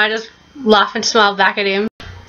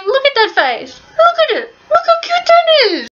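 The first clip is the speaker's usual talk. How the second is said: gushing squeal. Real, not acted. Gushing over a cute photo.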